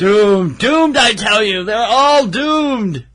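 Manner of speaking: slow ominous raspy drawl; high, loud doom-crying prophet; crazy old man. Pretend. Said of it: "crazy old man from the slasher movies".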